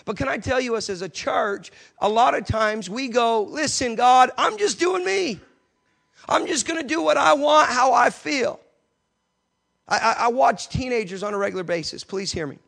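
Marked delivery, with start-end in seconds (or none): 3.4-8.6 mock careless churchgoer. Preacher mocking careless churchgoers talking to God.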